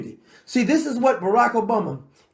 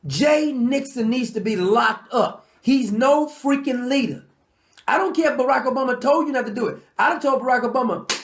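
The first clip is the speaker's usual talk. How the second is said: ordinary delivery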